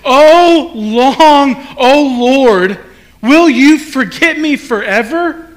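anguished prayer to the Lord; pleading lament. Retold or imitated. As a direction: plead with God like a desperate psalmist. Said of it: "starts out like this".

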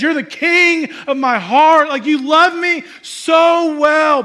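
high, loud, slow declaration of love; exalting praise. Retold or imitated. Wife honoring her husband, loud exalting praise. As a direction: proclaim it slowly, with full-voiced adoration.

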